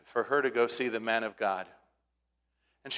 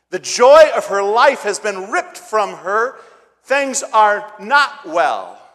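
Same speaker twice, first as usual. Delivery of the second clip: loud, high, slow pulpit cry, pleading; slow down and thunder the grief from the pulpit; preaching at full force; 8 semitones higher, 12 dB louder, slower than usual. Acted.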